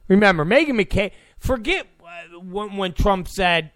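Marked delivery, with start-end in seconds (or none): none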